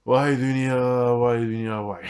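long, slow, loud sigh over the world, fading off; weary lament. Real, not acted. To himself about rotten people, a drawn-out loud lament. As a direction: stretch each vay like a heavy lament.

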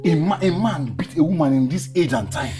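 loud, heated demands; outraged. Acted. Man demands to know where her abuser is, outraged. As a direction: burst out in protective fury.

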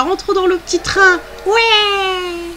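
high excited squeal, last word drawn out; gleeful cheer. Real, not acted. Player hopping aboard the little train, gleeful high cheer. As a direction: cheer it high like a thrilled passenger.

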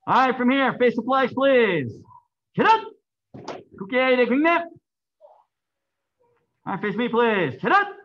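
loud, formal drill commands; shouted class commands. Real, not acted.

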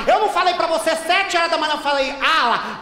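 normal talking voice

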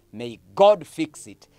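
ordinary talk

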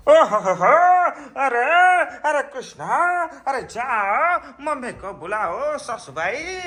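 mock filmy voice. Playful put-on voice to child.

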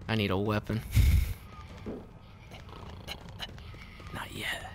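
breathy villain whisper, laughing under it; sinister whispered threat; creepy whisper. Pretend. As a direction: whisper it like a gloating killer. Whispered villain aside in game.

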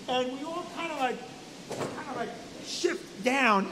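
suddenly soft, tiptoeing narration; sheepishly quieting down; hushed. Retold or imitated. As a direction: drop to a hush as if slipping in late. Acting out hushed arrival at tent.

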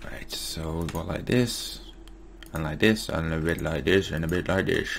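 half-whispered, lilting narration; odd sing-song murmur. Real, not acted. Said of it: "I don't know why I'm talking like that".

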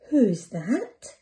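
usual speaking voice

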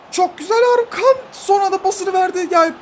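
high, loud cheering for the pass; delighted shout of praise; excited praise. Real, not acted. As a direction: cheer him on at the top of your voice. Praising his player after a pass.

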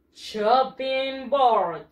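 "chopping board" at half pace, each part drawn out; model pronunciation. Shown as a demonstration. Teacher practises pronunciation with pupils, slow model pronunciation. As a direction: say "chopping board" very slowly, syllable by syllable. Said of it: "practice again the pronunciation".

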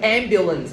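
Ambulance as AM-byu-lance, falling; stress on first syllable. Shown as a demonstration. Teacher models the last option's stress, first-syllable stress. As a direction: hit AM in Ambulance. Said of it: "Âm thứ nhất".

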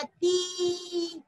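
ती with a long, held ee; long vowel. Shown as a demonstration. Teacher giving the pronunciation of ता with matra, long-held ती. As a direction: draw out the vowel of ती. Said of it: "उसका उच्चारण होगा".

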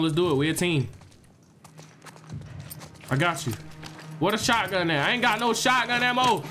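usual speaking voice

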